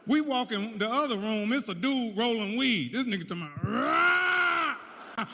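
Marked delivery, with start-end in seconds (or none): 3.6-5.2 high, drawn-out roar; monster roar. Retold or imitated. Weed-rolling worker trying to scare visitors, monster roaring. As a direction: roar like a haunted-house monster. Acting monster in haunted house.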